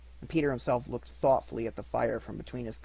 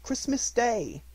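Bob's gentle plea; 9 semitones higher, slower than usual. Acted. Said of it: "said Bob".

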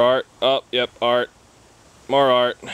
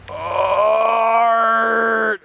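hollering in a forest; 8 semitones higher than usual. Pretend. Shouting art.

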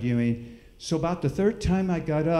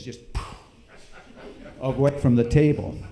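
normal talking voice

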